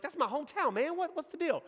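high-pitched mock indignation; affronted comeback. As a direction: act offended for your hometown, voice shooting up. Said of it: "like I would have responded and by insulting".

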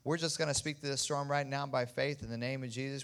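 loud commanding rebuke of the storm; laying a curse. Acted. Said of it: "And we curse you".

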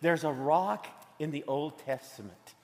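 softly, like sharing a secret. Acted. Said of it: "here's the secret I wanna tell you".

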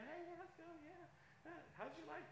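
a mock bubbly hello at the church door; fake church cheer. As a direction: paste on a fake smile and gush. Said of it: "I'm like".

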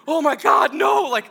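high, loud panicked outburst; mock panic. Young hired hand finds horses in garden, loud mock panic.

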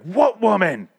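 high, loud startled "What woman?"; mock shocked outburst; alarmed question. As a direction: ask it in sudden alarm. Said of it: "Cordelia's like".

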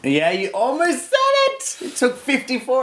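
high-pitched gleeful exclamation; crowing in mock amazement; excited teasing. Real, not acted. Teases co-host over near slip.

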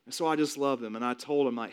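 ordinary talk

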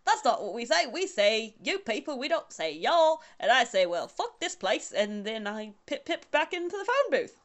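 hammed-up British accent. Recounting a row over y'all, mock British accent. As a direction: tell the yarn in a silly fake British accent. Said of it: "say y'all in a British accent".

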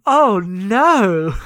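loud, long-drawn swooping "oh no"; mortified dismay. Retold or imitated. Cohost reacts to embarrassing poem, loud mortified dismay. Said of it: "it's embarrassing".

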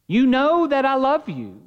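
mild grieved plea, slow; a touch of hurt in Peter's answer, slowed. Acted. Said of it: "he is now grieved".

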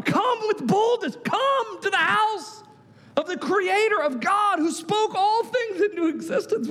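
high, strained, drawn-out begging; hammed-up imploring appeal; imitated pleading. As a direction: plead and coax with mounting insistence. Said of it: "Why does he have to plead with them?".

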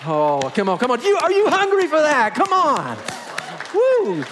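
high, full-force preacher's shout; real exultant shout. Real, not acted. Preacher urges congregation to give God praise, exultant shout. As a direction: rouse the crowd with a loud, rising holler. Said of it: "Some things just make you wanna shout".

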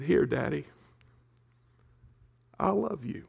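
a hint of a crying daughter giving up her treasure; tearful child. Tearful daughter handing her daddy the pearls, softly. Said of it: "She's been crying".

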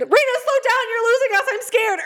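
high, whiny frightened pleading; mock scared plea. Lagging behind a friend in the haunted house, high scared whine. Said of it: "I said".

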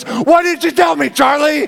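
high, outraged daughter's squeak; daughter's high indignant cry; indignant squeal. Retold or imitated. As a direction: mimic her shocked, shrill question. Daughter's shocked indignant question retold.

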